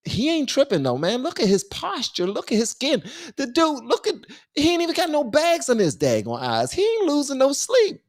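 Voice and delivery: high-pitched, animated admiration; impressed hyping. Real, not acted. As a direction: marvel at how unbothered he is. Marvels at the man's composure.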